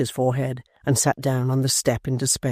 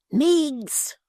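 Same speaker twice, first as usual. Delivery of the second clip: put-on higher, urgent young apprentice; hurried denial, half-hushed; Tappertit's voice; 13 semitones higher than usual. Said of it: "cried Mister Tappertit".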